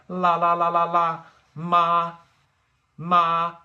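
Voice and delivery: LA and MA held high and level; high, unbending level tone on LA and MA; first tone. Shown as a demonstration. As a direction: hold each LA and MA on one high pitch, loud. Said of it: "It's a little bit higher than you think".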